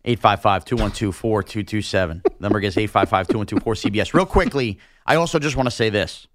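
normal talking voice

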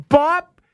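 a sudden high, loud "Bop!"; mock bump sound. Fan shoving a kid aside for the ball, a sudden loud pop. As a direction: pop it out like a body check.

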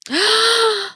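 long, high audible gasp; sharp dramatic intake of breath; shocked gasp. Real, not acted. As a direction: gasp in appalled recognition. Listener reacts to hated phrase.

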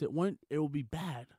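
ordinary talk, nothing marked

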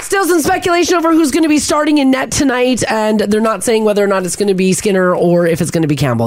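upbeat radio sports update; sports news read. Acted. Reads hockey news.

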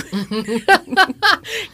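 high, bright burst of laughter. Real, not acted.

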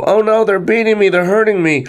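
high-pitched, narrated panic; distressed camel. A narrator's reading. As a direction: wail lightly in alarm, pitch well up. Camel being beaten, in distress.

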